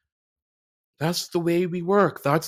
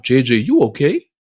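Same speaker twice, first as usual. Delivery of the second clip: louder, pointed question to herself; self check-in; 4 semitones lower, 8 dB louder than usual. Retold or imitated. Speaker confronting herself about denying her feelings, louder pointed question. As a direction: call yourself out firmly. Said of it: "I had to ask myself".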